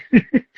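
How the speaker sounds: short amused chuckle; laugh. Real, not acted. Teller amused by [name]'s forgetting, chuckling. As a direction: chuckle at your own story. Laughs at his own anecdote.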